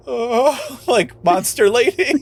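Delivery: put-on awed kid. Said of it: "he's a little bit like".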